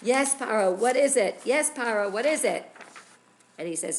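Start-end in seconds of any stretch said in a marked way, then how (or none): 0.0-2.7 loud, higher, prompt reply; eager answer. Acted. Storyteller as Moshe and Aaron running up to Paro, answering loudly. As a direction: answer the king's call urgently, voice raised.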